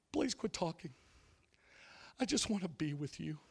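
syrupy preacher. Retold or imitated. Imitating the host quoting God tenderly, soft syrupy voice. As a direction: coo it like an overly sweet preacher. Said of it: "But this syrupy".

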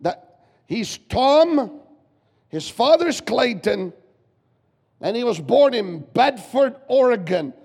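loud, high, slow preacher's cry; preaching at full force. Real, not acted. Pastor preaching to the congregation, loud and high. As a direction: proclaim it to the whole church. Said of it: "preaching at full force".

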